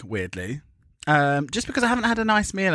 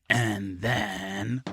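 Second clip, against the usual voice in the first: "and then" stretched long and lower; lingering, lowered "and then" left hanging; drawn-out suspense; 4 semitones lower, 4 dB quieter than usual. Real, not acted. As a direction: draw out "and then" in a low, teasing tone.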